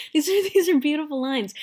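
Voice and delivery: laughing, moved. Real, not acted. Moved teacher laughs through praise.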